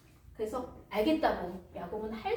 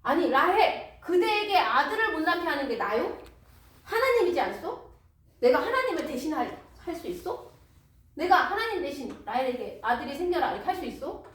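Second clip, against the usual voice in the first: higher, louder exasperated husband; angry retort; 5 semitones higher, 5 dB louder than usual. Acted. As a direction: snap back at a nagging wife. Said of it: "야곱이 듣다 듣다 못해 화가 났어요". (참다못해 아내에게 화를 내는 남편)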